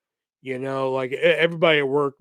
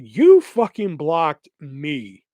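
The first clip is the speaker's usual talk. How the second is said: deliberate, slow and louder; slow accusation; 5 dB louder, much slower than usual. Retold or imitated. Retelling his message to the friend who blocked him, slow. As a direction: spell it out word by word.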